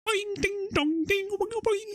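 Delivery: high-pitched springy sound-effect imitation; boing and ding like comic springs; cartoon boing noises. Mocking a film's silly sound effects, high cartoon boings. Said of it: "So it's these noises now".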